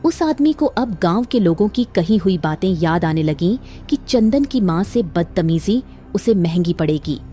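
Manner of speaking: usual speaking voice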